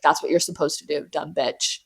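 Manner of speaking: low, quiet, dismissive; low, soft, flat dismissal of a child; quiet deadpan parent. Retold or imitated. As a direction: mutter it like a cold parent. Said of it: "It was just like".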